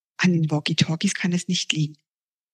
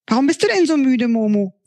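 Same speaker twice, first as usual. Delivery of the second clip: higher, quick worried question; curious boy; 6 semitones higher than usual. Acted. Pfiffi asks Momo why she's so tired, higher quick question. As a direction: ask your tired friend what's wrong.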